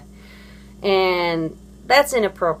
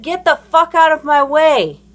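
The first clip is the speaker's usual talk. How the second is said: higher, louder angry order; retold angry demand; 6 semitones higher, 5 dB louder than usual. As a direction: order them out of your way. Retold confrontation with blocking parent.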